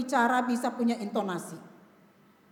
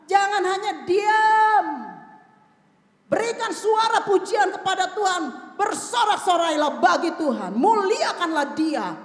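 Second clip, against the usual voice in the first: raised, high exhortation with drawn-out words; impassioned preaching; 6 semitones higher, 5 dB louder, slower than usual. Real, not acted. Preacher urging congregation to praise God, impassioned preaching. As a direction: rouse the congregation, stretching key words.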